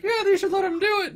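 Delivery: ventriloquized goblin chiming in; thrown goblin voice. Acted. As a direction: squeak it in as a hidden extra voice. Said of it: "you hear the voice where someone else is like".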